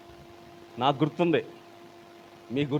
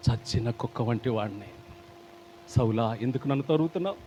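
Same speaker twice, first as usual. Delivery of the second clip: humble plea; 7 semitones lower, 7 dB quieter than usual. Acted. David's humble words voiced.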